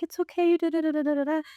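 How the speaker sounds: high, quick "it's okay, da da da"; aunties brushing it off. Retold or imitated. Aunties reassuring grandma after her apology, high breezy brush-off. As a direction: lightly mimic the aunties waving it away.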